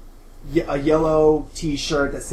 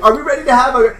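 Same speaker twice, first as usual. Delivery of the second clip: high, loud peppy rally; rally a sleepy cabin with forced cheer; perky camp girl; 9 semitones higher, 9 dB louder, faster than usual. Acted.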